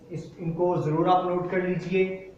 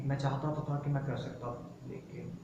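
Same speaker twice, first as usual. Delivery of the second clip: regretful trailing off; 3 semitones lower, 12 dB quieter than usual. Acted.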